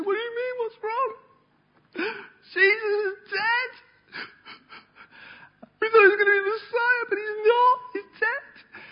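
high, crying voice of grieving disciples; sobbing disciples. Retold or imitated. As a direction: wail it through tears, pitched high. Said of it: "they're sobbing".

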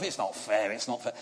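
low, soft muttered complaint; mock mutter. Boy muttering to himself on the landing, softly. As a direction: grumble it under your breath. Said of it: "muttering to myself".

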